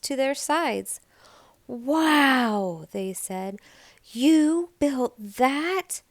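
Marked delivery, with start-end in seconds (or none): 1.7-2.9 a louder awed gasp; amazed wow. A narrator's reading. The big sisters see the snowman. 4.0-6.0 very slow, higher, louder disbelief; amazed disbelief. A narrator's reading. The sisters ask Nell about the snowman.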